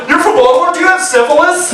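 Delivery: high, incredulous outsider voice; a hint of a horrified stranger; mock shocked outsider. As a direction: play the gawking tourist, aghast. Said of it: "they're like".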